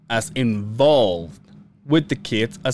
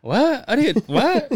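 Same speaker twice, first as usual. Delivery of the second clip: much higher, startled disbelief; baffled; 13 semitones higher, slower than usual. Retold or imitated. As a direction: sputter like you were caught out. Caught off guard by Brian.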